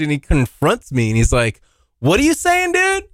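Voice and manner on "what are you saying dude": high-pitched confrontational mock yell; indignant challenge, pitched way up; retold angry yell. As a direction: reenact a kid storming up to confront someone. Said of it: "this kid comes out of his garage yelling at me".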